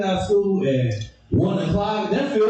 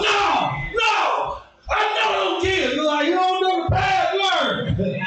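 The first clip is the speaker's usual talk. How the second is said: high, raised protest; arguing boyfriend; 13 semitones higher, slower than usual. Retold or imitated. Boyfriend arguing with the school office, raised protest.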